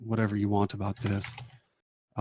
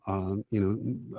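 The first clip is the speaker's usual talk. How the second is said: ordinary talk, nothing marked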